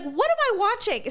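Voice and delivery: high, baffled question; can't believe your eyes; incredulous disbelief. Retold or imitated. Host recounting watching the finale, high baffled disbelief.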